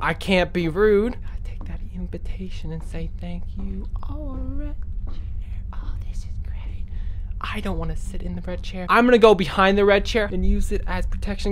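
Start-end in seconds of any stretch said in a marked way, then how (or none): 1.2-8.9 hushed sneaking whisper. Real, not acted. Sneaking past the monster.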